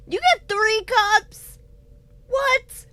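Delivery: high, loud disbelief; shocked at the news; surprised. Real, not acted. Disbelief at UK cups.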